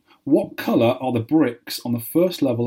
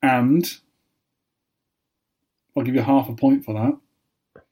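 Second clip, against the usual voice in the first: ordinary talk, nothing marked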